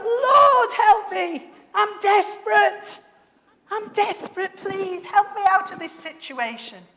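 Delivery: high, very loud desperate bawl; Jonah's desperate hollering. Acted. Jonah begs God from the fish's belly, desperate hollering. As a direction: holler for rescue, crying out at full force. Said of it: "that is a hollering, a bawling my eyes out".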